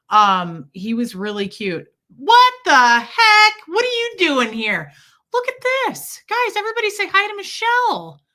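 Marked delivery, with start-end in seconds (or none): 2.2-8.2 high, loud, delighted burst; excited surprise. Real, not acted. Surprised to see a friend arrive.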